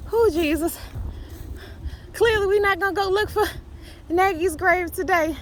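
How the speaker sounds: high, loud, quick exasperation; rattled. Real, not acted. Shaken vlogger giving up on the grave search, high and loud. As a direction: still keyed up, voice stays high. Still rattled after coyote.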